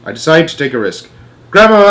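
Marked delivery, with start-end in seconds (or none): 1.5-1.9 high loud shout. Acted. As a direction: shout as loud as you can. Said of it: "I shouted as loudly as I possibly could".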